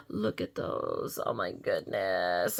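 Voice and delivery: gasp, then slow, low, very soft wonder; hushed awe. Real, not acted. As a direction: breathe it out slowly, awestruck. Awed gushing over tiny details.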